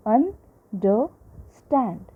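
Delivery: "understand" very slowly, split un-der-stand; drawn-out teacher's syllable count on "understand". Shown as a demonstration. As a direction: break "understand" into three beats with clear pauses. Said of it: "three syllables".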